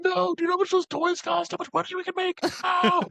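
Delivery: very high, strangled mock scream. Imitating Scott fighting to get out, high-pitched mock screaming. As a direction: squeal it like someone trapped inside. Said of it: "he's just screaming".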